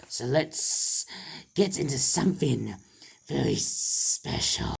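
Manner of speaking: whispered hissing snake voice. Pretend. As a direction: hiss the words out like a snake. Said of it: "Sorry I have to talk like a snake".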